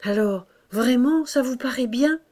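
mildly anxious plea for reassurance; anxious, needy. A narrator's reading. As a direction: ask like a pupil needing encouragement. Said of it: "de l'air inquiet d'un élève qui a besoin d'être encouragé".

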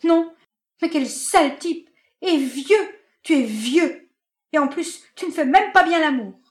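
high, loud spat insults. Acted. Marinette humiliating Benjamin at the door, loud high spitting insults. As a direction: spit the insults in his face. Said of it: "Elle lui avait craché cela au visage".

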